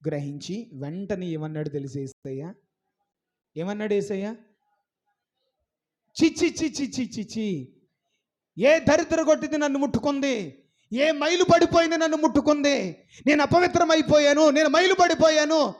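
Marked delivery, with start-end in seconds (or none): 6.2-15.7 a hammed-up squeamish man crying tut-tut in disgust; mock disgust at being made unclean. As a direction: act out a fussy man recoiling from a touch. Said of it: "అని చెప్పేసి అన్నాడా?".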